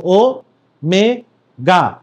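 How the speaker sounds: ও, মে, গা said as three separate beats with pauses; syllable by syllable ও মে গা; split into syllables. Shown as a demonstration. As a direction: break ওমেগা into ও, মে, গা. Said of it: "ভেঙে উচ্চারণ করলে কিভাবে উচ্চারণ করা যায়".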